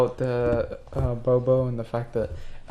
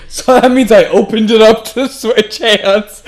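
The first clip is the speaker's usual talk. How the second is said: alarmed; 8 semitones higher, 14 dB louder than usual. Acted. Panicked realization in game.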